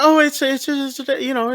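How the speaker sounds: high, stammering excuse; retold flustered stammer. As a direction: mimic someone fumbling for an excuse. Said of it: "she starts kinda acting weird".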